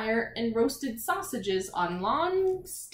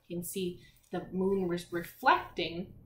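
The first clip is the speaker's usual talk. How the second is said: ordinary delivery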